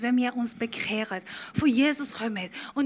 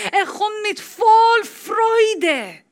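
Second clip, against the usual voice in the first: joyful shout; 10 semitones higher, 11 dB louder, much slower than usual. Acted. Climax of joyful sermon.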